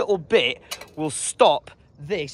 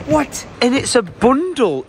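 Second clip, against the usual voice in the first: surprised; 8 semitones higher, 4 dB louder, slower than usual. Real, not acted. Surprised at a deal.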